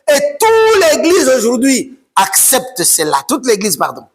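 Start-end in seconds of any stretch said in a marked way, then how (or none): none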